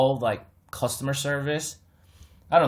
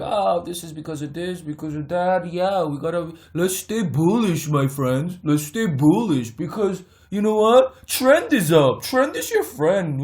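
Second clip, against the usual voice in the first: hammed-up cheerful bullish pundit; mocking a know-it-all YouTuber who hypes the trend; mock YouTube bozo; 3 semitones higher than usual. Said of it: "these bozos out there on YouTube".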